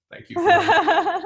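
high, slow, laughing thank you; laughing mock thanks. Turning down an offer with a laughing, drawn-out thanks. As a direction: drag it out through a laugh.

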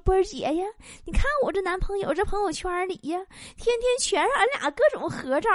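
high, soft, simpering show-off; mock bragging. Friend flaunting couple photos to the host, mock bragging. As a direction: imitate a friend coyly showing off her boyfriend. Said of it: "跟我俩显摆呢".

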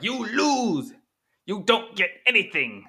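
high, loud furious yell; Palpatine-like angry yell. Retold or imitated. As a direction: scream the verdict at the kids like an evil emperor. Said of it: "I sound like Palpatine".

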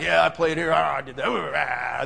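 low, soft mock cowboy grunting; cowboy bonding talk. Two players bonding over football, mock cowboy grunting. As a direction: imitate two old players swapping grunts. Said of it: "kinda do the cowboy thing".

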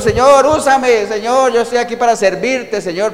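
high, drawn-out mock plea; crying out to God. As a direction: plead like a worshipper clamouring to be used. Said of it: "muchas veces la gente clama a Dios". (clamando a Dios en tono suplicante)

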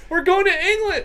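high, loud yelling as the wheel stops; excited shout. Real, not acted. Host shouts as the wheel lands on England. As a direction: cheer it out. Celebrating result.

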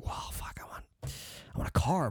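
awestruck, hushed whisper; envious whisper. Retold or imitated. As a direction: whisper it under your breath in envy. Envious reaction to buddy's new car.

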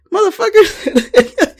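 high, drawn-out curse through laughter; laughing disbelief. Retold or imitated. New lead reacting to coworkers' sudden English, laughing disbelief. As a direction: stretch the swear slowly as laughter takes over. Laughing at the team's language switch.

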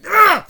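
loud, high "ugh"; revolted ugh. Real, not acted. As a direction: burst out with a noisy ugh. Revolted outburst at the picture.

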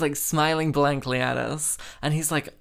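ordinary delivery

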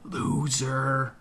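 soft, hushed, drawn-out jeer; mouthed taunt. A narrator's reading. Little brother silently mouths an insult to the narrator, in a hushed taunt. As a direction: stretch the insult out under your breath. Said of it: "Mac mouths".

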